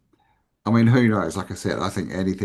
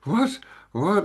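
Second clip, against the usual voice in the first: high, shocked double what; a man suddenly slapped, baffled and shocked; startled what; 11 semitones higher than usual. Retold or imitated. Slapped stranger caught off guard, high startled disbelief. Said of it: "And he's like".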